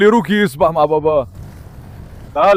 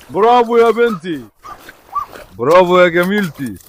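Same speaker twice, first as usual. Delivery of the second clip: proud praise. Real, not acted. Owner praising his girl dog as she swims, proud cheer. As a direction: stretch the praise out, pitched up.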